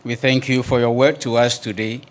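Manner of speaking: soft, lowering prayer close; closing prayer. Acted. Pastor ending the prayer before the church, softly reverent. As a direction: ease into the amen gently.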